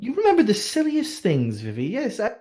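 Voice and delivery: giggly high court lady; high-voiced noblewoman. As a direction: play her airy and amused, lifted high.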